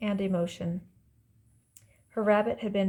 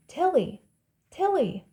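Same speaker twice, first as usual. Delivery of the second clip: high, louder name call; 8 semitones higher, 5 dB louder than usual. A narrator's reading. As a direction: call to someone from a distance. Others call her name from afar.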